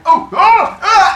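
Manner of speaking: high loud gasps; startled yelps. Retold or imitated. Cronus tripping while holding the baby, loud high yelps. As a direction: yelp as you trip backwards.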